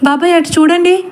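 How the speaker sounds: put-on high, terrified shout of a girl; scared call to her uncle; frightened cry. A narrator's reading. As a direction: cry out in sudden terror. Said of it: "పెద్దగా అరిచి".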